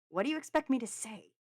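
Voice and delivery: high, loud exasperation; exasperated retort in a quarrel; frustrated. A narrator's reading. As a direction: throw the question back, fed up. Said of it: "raising his hands in frustration".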